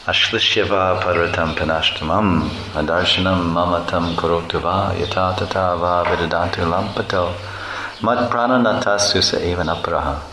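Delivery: measured Sanskrit recitation; recited verse. Acted. The teacher recites a Sanskrit verse, measured and devotional.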